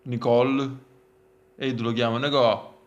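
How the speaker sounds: ordinary talk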